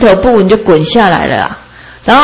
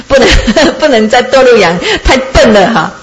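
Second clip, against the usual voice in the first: laughing; 2 semitones higher than usual. Acted. Freed sheep jokes to itself.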